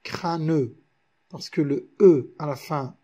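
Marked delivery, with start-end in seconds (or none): 0.0-0.8 crâne said as "crâneux", in two syllables; "crâneux" with an added "-neu" ending; wrongly sounded E. Shown as a demonstration. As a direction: say "crâneux", voicing the mute E. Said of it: "On ne peut pas dire".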